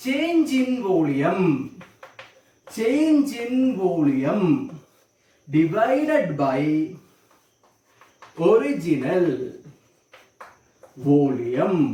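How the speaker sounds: drawn-out words, long gaps between them; slow dictation. Real, not acted. Teacher giving students the volume strain formula, slow dictation. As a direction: dictate it piece by piece, very slowly.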